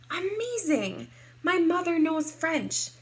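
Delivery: high-pitched delighted exclamation; amazed. Acted. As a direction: exclaim like an impressed little girl. Child amazed at mother's French.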